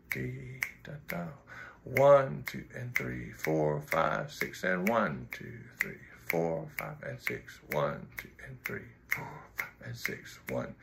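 slow counted six eight; counting the beats aloud, low and even; counting slowly. Shown as a demonstration. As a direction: count it out steadily. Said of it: "I'm not gonna play it that fast".

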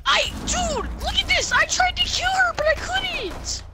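high-pitched excited squeal; frantic disbelief. Real, not acted. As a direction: blurt it out high and overwhelmed. Frantic after triple knife kill.

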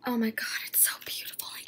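soft breathy whisper, quick; awed whisper. Real, not acted. Collector seeing the swimsuit up close, whispering quickly. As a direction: gasp it out in wonder.

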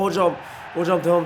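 ordinary talk

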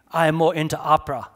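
put-on just a touch of German accent; light German accent. A narrator's reading. German wife answering Charlie at the radio station, lightly accented. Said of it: "That an accent I hear?".

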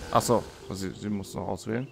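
normal talking voice

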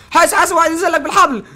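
loud, high alarm call; urgent warning shout. Real, not acted. Gamer shouting a warning to his partner, loud and alarmed. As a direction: yell a warning to your teammate.

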